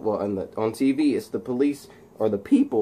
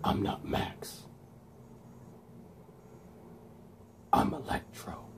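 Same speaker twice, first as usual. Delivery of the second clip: whispered villain reveal; 2 semitones lower, 14 dB quieter than usual. Retold or imitated. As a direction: whisper each sentence apart, a long pause between. Acts out Max becoming Electro.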